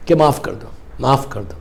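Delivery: dropping to a soft, pleading "معاف کر دو"; softly urging the congregation to forgive; soft plea. Real, not acted. Preacher pleading with audience to forgive.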